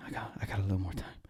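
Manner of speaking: soft, fast murmur of relief; quiet relief. Retold or imitated. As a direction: breathe it out to yourself, hushed. Waking relieved from nightmare.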